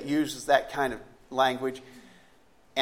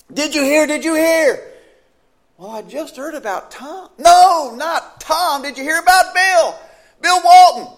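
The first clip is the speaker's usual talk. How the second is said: much higher and louder, urgent; breathless alarm down the phone; second caller in a panic with news; panicking; 12 semitones higher, 10 dB louder than usual. Acted. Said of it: "they are also in a panic".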